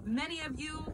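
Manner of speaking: normal talking voice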